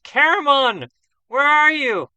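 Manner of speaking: high, loud, desperate shouting of a name; anguished cry. Acted. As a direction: scream for your lost friend in panic. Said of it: "He cries out in anguish".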